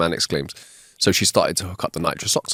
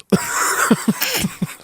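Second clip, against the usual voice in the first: laughing. Real, not acted. Laughs at own joke.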